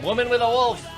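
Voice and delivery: ordinary talk, nothing marked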